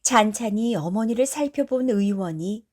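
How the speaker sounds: plain reading voice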